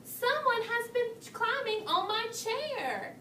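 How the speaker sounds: mama bear aghast, lightly acted; exclaiming. A narrator's reading. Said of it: "Mama Bear exclaimed".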